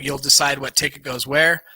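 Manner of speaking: ordinary talk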